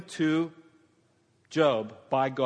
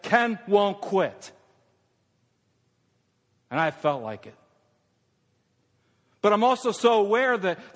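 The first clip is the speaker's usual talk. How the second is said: loud, high preaching build; preaching at full force; 6 semitones higher, 7 dB louder than usual. Acted. Pastor preaching hard to his congregation, loud and fervent. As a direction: lift the church with rising fervour.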